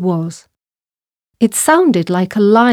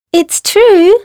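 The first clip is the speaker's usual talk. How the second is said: very high, louder mouse voice; little mouse; 11 semitones higher, 5 dB louder than usual. A narrator's reading. Mouse insisting to laughing lion, high urgent voice. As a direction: insist as a tiny squeaking mouse. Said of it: "said the mouse".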